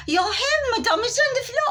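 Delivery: very high mocking French echo; mock French accent. Woman mocking the stylist in French accent. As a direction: mimic her snootily. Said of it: "I said".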